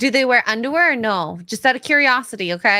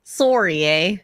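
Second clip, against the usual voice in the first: mock Canadian; 3 semitones lower, slower than usual. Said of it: "how they say sorry".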